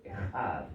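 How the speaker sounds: Echad with a strong rasped J like Spanish jota; hard J sound. Shown as a demonstration. Pastor teaching Hebrew sounds the harsh J in Echad. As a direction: push air through the throat on Echad. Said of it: "Es una J fuerte".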